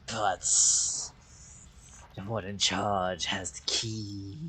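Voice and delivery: drawn-out, hushed wolf voice. Acted. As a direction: state terms as a patient beast, slowly. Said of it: "this voice for the wolves".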